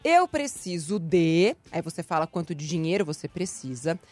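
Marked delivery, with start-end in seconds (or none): 0.0-1.5 "Eu preciso de" said very slowly, each word spaced; slow template read. Shown as a demonstration. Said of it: "a frase é a seguinte".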